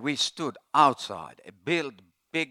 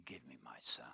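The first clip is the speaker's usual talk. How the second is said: whispered prayer; 9 dB quieter than usual. Retold or imitated. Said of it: "And she said".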